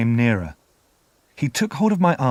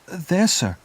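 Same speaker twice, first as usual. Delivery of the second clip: put-on timid boy's answer; 7 semitones higher than usual. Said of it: "I answered".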